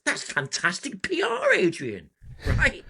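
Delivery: high, bright-side spin on bad news; mock eternal optimist. [name] to his agent Adrian, mock optimistic. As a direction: sell the disaster as good publicity, playfully. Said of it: "being the eternal optimist".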